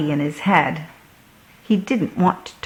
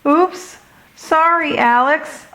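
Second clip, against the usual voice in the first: much higher, hurried little apology; quickly; 9 semitones higher than usual. A narrator's reading. Hudson apologizing to Alex after crashing his ship, higher and hurried. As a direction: toss off a sorry while zooming past. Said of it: "Hudson said quickly".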